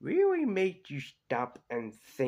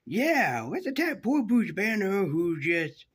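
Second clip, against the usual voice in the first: high, loud mock enthusiasm; sarcastic mock cheer; 9 semitones higher, 5 dB louder, slower than usual. Narrator mocking the military's attack on Banner, sarcastic mock cheer.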